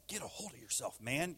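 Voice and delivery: low, hushed self-scolding; lightly acted voice in one's own head; inner voice. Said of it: "this voice inside of me is like".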